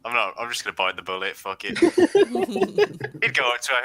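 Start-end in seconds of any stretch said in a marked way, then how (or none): 1.8-3.4 slow drawn-out. Acted. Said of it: "Who am I kidding?".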